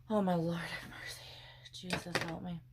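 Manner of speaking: whispered, half-joking plea. Real, not acted. Struggling to pick up her eggs, whispering. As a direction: breathe it out as a whisper.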